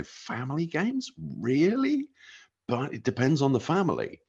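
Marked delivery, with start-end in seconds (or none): 0.0-2.1 incredulous. Retold or imitated. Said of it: "You look at those and go".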